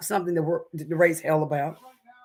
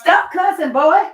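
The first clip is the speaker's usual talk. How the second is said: scolding; 12 semitones higher, 7 dB louder, slower than usual. Real, not acted. Scolding cousin for cursing.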